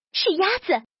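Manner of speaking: storybook characters' excited squeal; excited shriek. A narrator's reading. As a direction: squeal it out in delighted recognition. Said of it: "他们一边尖叫".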